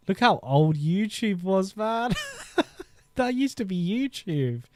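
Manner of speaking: laughing at ancient YouTube. Real, not acted. Laughing at old YouTube layout.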